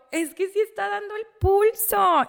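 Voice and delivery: high, loud retold protest; desperate insistence. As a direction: insist against the doctor, voice climbing high. (protesta desesperada, aguda y fuerte)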